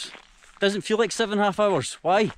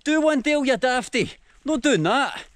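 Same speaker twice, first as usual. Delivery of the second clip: much higher, teasing brush-off from a travel buddy; mock exasperated mate; 8 semitones higher than usual. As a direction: squeal it like a mate refusing. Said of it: "They'd be like".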